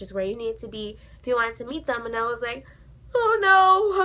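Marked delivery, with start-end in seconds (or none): 3.1-4.0 mock panic. Panics seeing the crowd.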